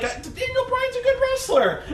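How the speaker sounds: very high-pitched mock protest; protesting colleague. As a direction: imitate a squeaky, flustered co-commentator. Acted protest by other commentator.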